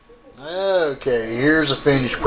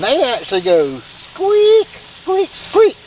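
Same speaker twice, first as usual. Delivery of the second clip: squeaky imitation; 10 semitones higher, 3 dB louder than usual. Imitating chicken squeaking on grill.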